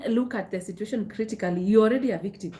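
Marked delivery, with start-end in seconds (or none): none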